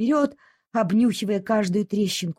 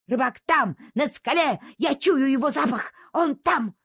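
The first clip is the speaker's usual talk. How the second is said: higher, thrilled cries; excited dog; 6 semitones higher than usual. Acted. Dog telling everyone the fisherman is there, excited. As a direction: point and cry out eagerly.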